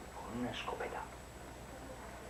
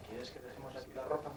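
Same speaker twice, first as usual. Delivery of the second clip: ordinary delivery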